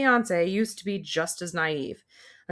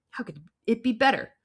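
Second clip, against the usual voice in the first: usual speaking voice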